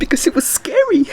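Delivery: high squeaky mock whine; high mocking crybaby voice. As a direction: mimic a crybaby. Mocking the crying girl.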